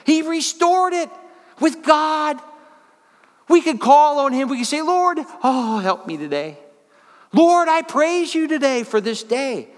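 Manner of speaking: high, loud, fervent preaching; worked-up preacher going off the deep end; preaching at full force. Real, not acted. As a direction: let it rip from the pulpit. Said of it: "He's going off the deep end".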